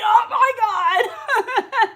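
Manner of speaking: loud, high re-enacted shriek, drawn out; shocked shriek. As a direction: relive the shock, shrieking through laughter. Retells own shocked reaction.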